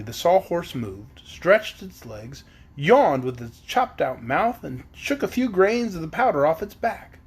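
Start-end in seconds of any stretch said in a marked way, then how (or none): none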